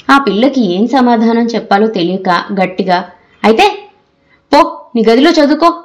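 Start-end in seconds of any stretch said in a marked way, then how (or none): 3.4-5.7 put-on mother scolding the girl away to her room; scolding shout. A narrator's reading. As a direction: order her out in a temper. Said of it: "అంటూ తిట్టింది".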